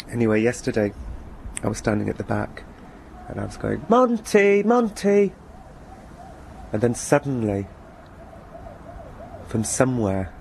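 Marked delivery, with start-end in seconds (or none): 3.9-5.3 calling the cat in a much higher, carefree sing-song; cheerful high-pitched call. Retold or imitated. Husband calling the cat from the back door, high cheerful call. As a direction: sing out the cat's name high and happy. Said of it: "shout for our cat in a cheerfully high-pitched".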